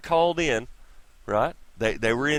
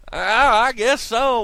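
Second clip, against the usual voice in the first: higher, louder sheepish mock reply; mock dumb parent; 8 semitones higher, 6 dB louder, slower than usual. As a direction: play a clueless parent admitting it.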